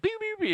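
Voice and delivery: very high little checkout beeps; imitated scanner beeps. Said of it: "the cashier's like".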